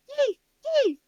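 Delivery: put-on high demanding baby voice; whiny toddler. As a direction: wail for juice like a toddler. Baby girl in a fit.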